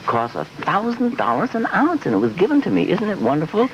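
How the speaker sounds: high, breathless rave about a pricey perfume; gushing secretary. Acted. Secretary showing off her perfume to the boss, high and gushing.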